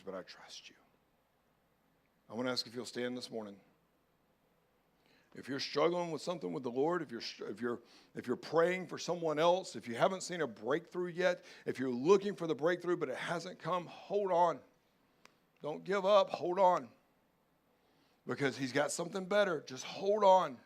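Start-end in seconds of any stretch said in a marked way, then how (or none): 0.0-0.7 unvoiced whisper of surrender; hushed whisper, letting go; whispered prayer. 5.3-20.6 louder, higher urgent exhortation; rally them to hold on; impassioned altar call; preaching at full force. Acted.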